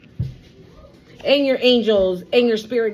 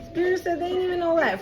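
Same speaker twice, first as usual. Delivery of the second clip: laughing retelling; 7 semitones higher, 4 dB quieter, faster than usual. Real, not acted. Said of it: "and Spirit says they ain't even know that".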